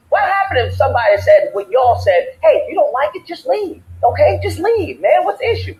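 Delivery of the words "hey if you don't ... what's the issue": high-pitched mocking brush-off; mock dismissiveness. Posing as someone telling the colonists to leave, high and dismissive. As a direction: imitate someone waving off a complaint, pitched well up. Mimics dismissive advice to protesters.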